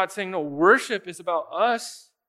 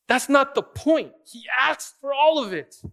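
raised, high-pitched, loud insistence; emphatic rebuttal; 8 semitones higher, 6 dB louder than usual. Real, not acted. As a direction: preach it forcefully, driving the correction home. Preacher rebuts the sons' excuse.

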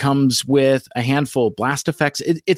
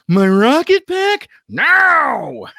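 high-pitched, loud cartoon outburst; hammed-up squawk, pitched way up; Sideswipe; 4 dB louder, much slower than usual. Podcaster re-enacting a rocket pack scene, hammed-up high shout. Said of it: "And Sideswipe".

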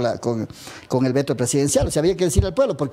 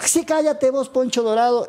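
high-pitched mock jeer; imitating a heated retort, no real shout; retold insult; 7 semitones higher than usual. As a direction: re-enact hurling an insult back, pitch well up.

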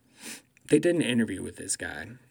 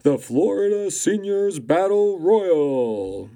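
loud, slow boxing announcer call; drawn-out big ring intro; 6 semitones higher, 6 dB louder, slower than usual. Shown as a demonstration. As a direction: boom it out like a fight night ring announcer. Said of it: "Try it again".

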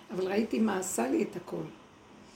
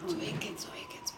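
hushed rush; 4 semitones lower, 6 dB quieter, much faster than usual. Retold or imitated. Screaming at night retold.

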